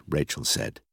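ordinary delivery

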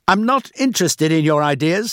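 high, loud, cross rebuff; irritated dismissal. A narrator's reading. Boss dismissing Rachel's new idea, irritated. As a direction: cut her off with annoyance.

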